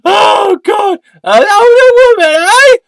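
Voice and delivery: very high, loud exclamation; squeaky gleeful echo. Acted. Sidekick teases about the older date, shrill gleeful echo. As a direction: squeal it after a cackle. Alter ego echoes with glee.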